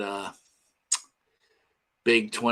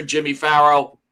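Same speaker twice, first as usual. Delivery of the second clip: ordinary talk, nothing marked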